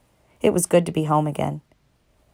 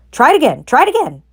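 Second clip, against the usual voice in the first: high loud urging; cheering; 8 semitones higher, 5 dB louder than usual. A narrator's reading. Goose urging Wilbur to dodge again. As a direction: egg him on. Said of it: "cried the goose".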